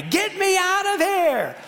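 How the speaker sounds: high-pitched, drawn-out frightened wail; yelling child. Retold or imitated. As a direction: imitate a scared little girl yelling. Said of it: "she started yelling at me".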